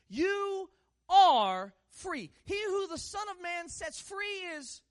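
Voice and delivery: loud, high, stretched-out words; forceful proclamation. Real, not acted. Preacher proclaiming freedom to church, loud and drawn out.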